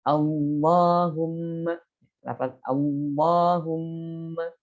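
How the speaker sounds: slow allahumma with a heavy, dark lam; thick lam. Shown as a demonstration. Teacher demonstrates another tafkhim example. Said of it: "lafaz Allah yang tafkhim".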